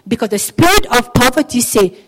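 usual speaking voice

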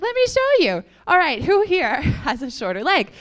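high, loud announcement to the class; excited healer. Retold or imitated. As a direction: call out brightly, rallying volunteers.

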